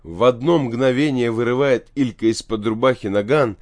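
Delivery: plain reading voice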